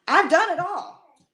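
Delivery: loud, slow declaration of a past; emphatic. Real, not acted. To viewers, owning her whole past, emphatic and loud. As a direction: hit every word, owning it.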